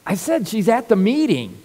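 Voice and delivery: a dad losing patience with a repeated question; impatient father repeating himself firmly; irritated dad. Retold or imitated. Impatient dad snaps at son.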